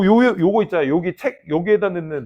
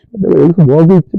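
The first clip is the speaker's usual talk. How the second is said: mock despair; 15 dB louder than usual. Students despairing while studying.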